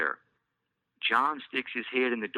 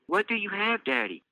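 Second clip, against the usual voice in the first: put-on curious child; 11 semitones higher than usual. Child Elise voiced.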